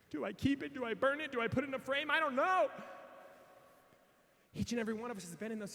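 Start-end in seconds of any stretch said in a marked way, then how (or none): 0.1-2.7 raised-pitch questions building to a loud finish; agonize, ending in a helpless cry; conflicted outburst. Retold or imitated. Torn wearer agonizes over the shirt.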